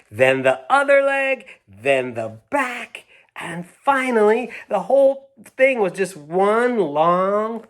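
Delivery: high, slowed, stretched telling of the unraveling; reading the happy ending to children with delight; excited storybook reading. Acted. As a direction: savour every piece as it flies away. Reads the climax to children.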